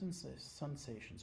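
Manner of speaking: ordinary talk, nothing marked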